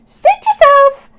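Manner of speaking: high, loud offhand squawk; breezy seagull. Acted. Sammy shrugging off Oscar, high breezy squawk. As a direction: toss it off as she flies away. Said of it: "Sammy cried".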